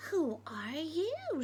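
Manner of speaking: slow, hushed whisper asking who's there; a wary whispered who are you; Bill whispers his question. Acted.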